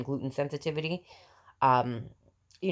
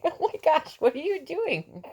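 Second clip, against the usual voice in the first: mother talking to her infant, high and sing-song. Real, not acted. Mom cooing to her baby.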